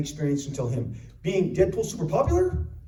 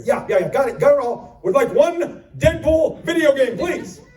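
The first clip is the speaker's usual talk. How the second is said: higher, louder, clipped-off insistence; impatient customer; 7 semitones higher, 5 dB louder than usual. Retold or imitated. Customer interrupting the clerk, louder and impatient. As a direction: cut the clerk off. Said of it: "impatient reply".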